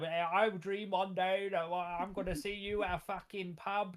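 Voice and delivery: slightly weepy Cockney impression; a touch of fake crying; mock tearful Alfred. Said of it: "he's just crying all day".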